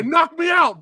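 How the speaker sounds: high, loud shouted dare; echoing the shouted challenge; mock shout. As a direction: yell it along.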